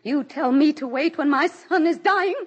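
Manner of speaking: high, loud desperate protest; anguished outrage. Acted. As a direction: fight for the dying son. Nurse says wait.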